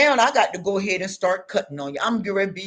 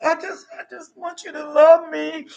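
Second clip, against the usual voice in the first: imitating crying plea; 8 semitones higher, 4 dB louder than usual. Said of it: "crying and".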